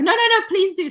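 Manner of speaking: retold eager go-ahead; eager insistence. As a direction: urge them on eagerly, pitch jumping up. Acting out his eager consent.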